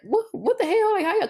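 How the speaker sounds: high-pitched mock bafflement; baffled. Onlookers puzzled by their friendship, high and baffled.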